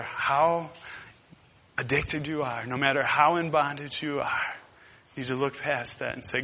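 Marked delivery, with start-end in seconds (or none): none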